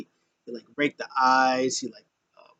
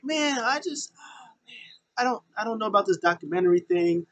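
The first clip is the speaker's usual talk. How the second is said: higher, doubtful voice with a whispered aside; mock Kevin Owens; 10 semitones higher than usual. Kevin Owens doubting Sami's documentary idea, higher skeptical voice. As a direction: voice a sceptical friend, pitched up. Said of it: "Kevin Owens is like".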